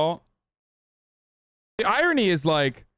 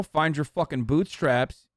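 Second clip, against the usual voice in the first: ordinary talk, nothing marked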